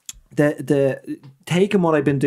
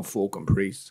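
muttered grumble; 6 semitones lower, 6 dB quieter, slower than usual. Retold or imitated. Dad mutters after priest leaves.

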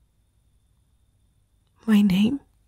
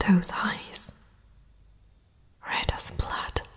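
near-whispered, trailing into breath; whispering at a frightening sight; hushed dread; 7 dB quieter than usual. Acted. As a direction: let it fade to an awed whisper. Frightened princess sees intruder's eyes.

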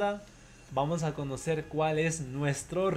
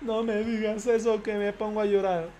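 mock crying; 5 semitones higher, 4 dB louder than usual. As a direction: fake whiny sob voice, higher and louder. Repite como llanto fingido. (llanto fingido, voz chillona de burla)

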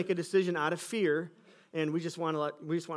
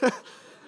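short nervous laugh; 7 dB louder than usual. Real, not acted. Laughs after nervous quote.

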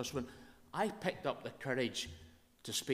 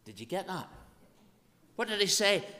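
ordinary talk

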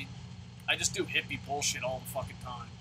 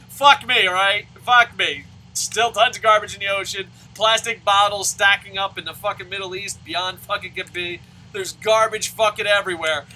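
loud, indignant tirade, pitched up; sarcastic rant; 3 semitones higher, 12 dB louder than usual. Real, not acted. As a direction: rant at full volume in scornful outrage. Sarcastic rant about being taxed.

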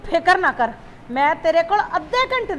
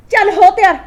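louder, higher, urging him to hurry; urgent hurrying; 5 semitones higher, 4 dB louder than usual. Acted. Mother rushing her son to get dressed, urgent and loud. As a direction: push him out the door now.

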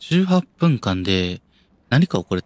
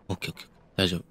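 hushed self-reassurance; 3 semitones lower, 8 dB quieter, much faster than usual. Real, not acted. Calming himself down quietly.

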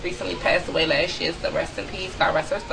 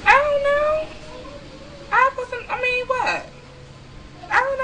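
much higher, louder, baffled "I don't know"; baffled squeal; 3 dB louder than usual. Real, not acted. Stumped by the soda question on camera, baffled high squeal.

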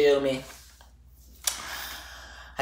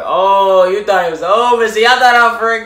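teasing viewers; 5 semitones higher, 6 dB louder than usual. Real, not acted. Teasing viewers who thought it over.

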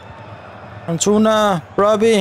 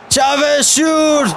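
yelled order; 7 semitones higher, much slower than usual. Real, not acted. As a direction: shout it at the player, higher. Urging player to shoot in game.

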